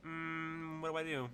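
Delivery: unsure. Acted. Unsure character asks Fixer for help.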